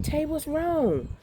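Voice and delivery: high, drawn-out concerned question; worried mother. Retold or imitated. Mother asking her son what is wrong, worried. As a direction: re-enact a worried call, high and slow.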